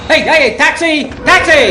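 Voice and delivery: high loud calls for a taxi; hailing a cab. Acted. Man hails a taxi in the street, calling out. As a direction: flag down the cab.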